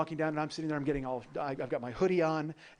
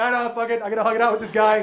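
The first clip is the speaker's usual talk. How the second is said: loud, high-pitched comic holler; retold exuberant shout; defiant declaration; 6 semitones higher, 11 dB louder, faster than usual. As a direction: announce it over the waiting crowd. Acted shout to waiting passengers.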